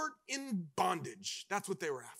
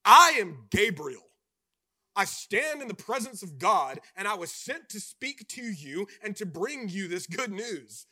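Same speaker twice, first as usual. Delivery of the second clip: commanding angel; 8 dB louder than usual. Acted. Angel Gabriel rebukes Zechariah.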